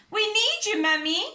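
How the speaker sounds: high, loud cry for help; shouting. A narrator's reading. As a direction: call Mummy from the kitchen. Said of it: "shouted Peppa".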